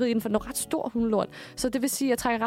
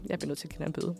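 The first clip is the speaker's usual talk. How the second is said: low, much softer, flat official voice; stern inspector; 6 semitones lower, 10 dB quieter, much faster than usual. Retold or imitated. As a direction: deliver the verdict coolly like the inspector. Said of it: "Og hun er bare sådan".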